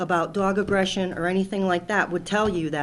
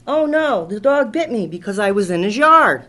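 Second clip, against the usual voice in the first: mock whiny kid; 9 dB louder than usual. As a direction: make fun of the kid's excuse, louder. Mocking the bitten kid's claim.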